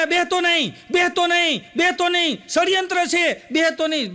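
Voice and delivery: a groom yelling in his sleep; yelling in a dream. Retold or imitated. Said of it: "રાડું નાખતો હતો".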